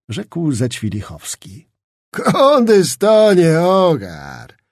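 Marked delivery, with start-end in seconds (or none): 2.1-4.6 higher, very slow, loud pompous drawl; fat nobleman. A narrator's reading. Zagłoba approaching Skrzetuski, slow pompous braggart voice. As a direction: savour each word like an old braggart. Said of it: "rzekł zbliżając się gruby szlachcic".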